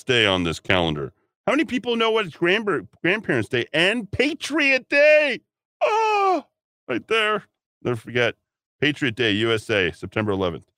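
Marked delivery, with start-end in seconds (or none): 1.5-7.4 high excited voice. Acted. High-pitched excitement over calendar.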